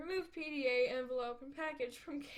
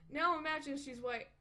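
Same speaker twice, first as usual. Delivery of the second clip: ordinary talk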